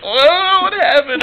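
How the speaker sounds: high, mock startled question; a hammed-up gasp of surprise; imitating surprise. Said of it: "she's like".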